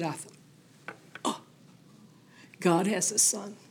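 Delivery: hushed, breathy wonder, barely voiced; awed whisper. [name] recalling the moment she realized God has a son, in an awed whisper. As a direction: whisper it like a sudden revelation.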